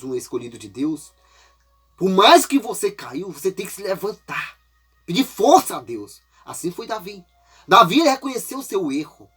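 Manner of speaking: ordinary talk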